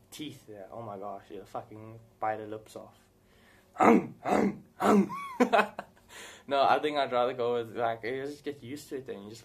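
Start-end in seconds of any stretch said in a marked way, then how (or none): 3.8-5.8 loud, high repeated "hum" chomps; imitated chomping. Vlogger acting out teeth biting, loud mock chomping. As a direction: make exaggerated biting noises, then laugh.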